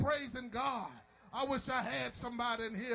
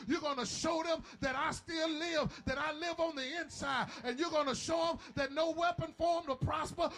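full-force preaching; 4 semitones higher than usual. Acted. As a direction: whip the church up, rising to a shout. Preacher builds to a shout.

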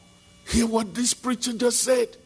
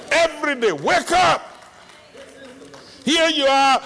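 higher and louder, driving each phrase at the crowd; shouted preaching; 6 semitones higher, 4 dB louder than usual. Acted. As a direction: rouse the congregation, shouting the scripture. Preacher urges congregation, shouting.

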